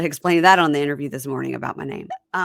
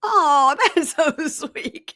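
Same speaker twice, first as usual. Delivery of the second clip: high, loud, laughing coo; gushing; 10 semitones higher, 6 dB louder, slower than usual. Real, not acted. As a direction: gush over how adorable it is. Gushing at co-host's mother's pet name.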